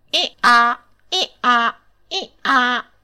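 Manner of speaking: put-on two boys turned donkeys braying together. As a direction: bray like a donkey. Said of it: "ragliando sonoramente facevano tutte e due in coro".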